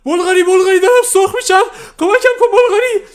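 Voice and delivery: shrill, very high and loud scream; screaming for help. Acted. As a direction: scream in panic while being fried.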